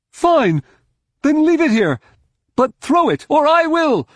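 very high, loud ultimatum; high-pitched, forceful argument; exasperated shouting. A narrator's reading. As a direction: yell impatiently at a stubborn ally. Droma arguing urgently mid-battle.

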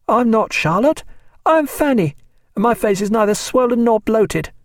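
put-on high, piping child's protest; a small girl insisting she is Fanny; indignant little girl. A narrator's reading. Young daughter correcting the doctor, high and indignant.

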